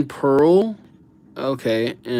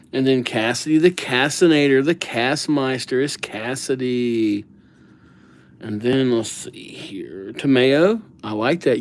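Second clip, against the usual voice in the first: normal talking voice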